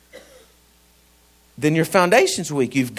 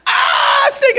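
startled yelp; 3 dB louder than usual. Retold or imitated. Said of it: "I was like".

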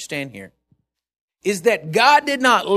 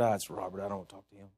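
mock God brushing him off; 11 semitones lower, 14 dB quieter, faster than usual. God voiced, caller ID joke.

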